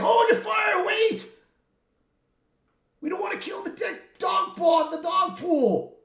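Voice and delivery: high, loud panicked yelling; frantic shouting. Retold or imitated. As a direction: shout a panicked stop order mid-battle. Frantic shouting during the fight.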